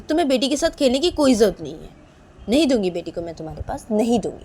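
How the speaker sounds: loud, firm forbidding; angry refusal. Acted. Manisha forbidding Nisha to play with baby, angrily.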